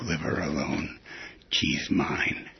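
punchline replayed low and quiet, trailing into a whisper; soft repeat. Host quietly repeating the joke's punchline, hushed and soft. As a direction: murmur the tag line to yourself.

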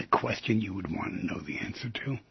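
breathy confiding whisper. Real, not acted. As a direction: lean in and whisper it. Confiding aside to listeners.

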